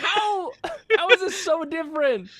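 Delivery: loud, very high, laughing bafflement; mock baffled outburst. Traveler retelling his bafflement at being misunderstood, mock outburst. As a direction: exclaim it in laughing disbelief.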